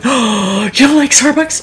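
gasping, high mock disbelief; mock shocked people. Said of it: "there's lots of people going".

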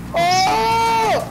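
sudden high yelp; loud startled cry; surprised shout. Real, not acted. Crashing through trees.

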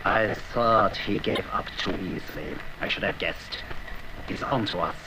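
low, slow and quiet, a threat held back; cold menace. Acted. Villain quietly vows revenge on Courage, low and menacing. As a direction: let the menace simmer under the breath. Villain realises he's been tricked.

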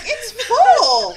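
overloaded with the bag, laughing; laughing exclaim. Retold or imitated. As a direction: squeal it, cracking up. Retold protest, laughing.

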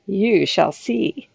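slow, looming ogre promise; slow it right down like a threat; a slow, ominous ogre voice; menacing ogre. Acted.

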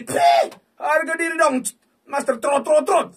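high, loud and slow; drawn-out shouted protest; indignant shout. Real, not acted. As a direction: yell it at the screen in protest. Shouting at slot machine.

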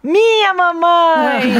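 put-on high, slow whiny child shout; child's cry. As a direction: wail it like a toddler claiming mom. Criança disputando a mãe. (grito choroso de criança pequena)

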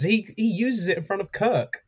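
normal talking voice

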